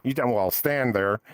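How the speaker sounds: normal talking voice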